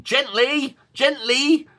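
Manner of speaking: high sing-song "Gently, gently" like a parent; parent saying gently. Retold or imitated. Parent warning an older sibling near a new baby, high sing-song. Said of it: "for parents, we're saying".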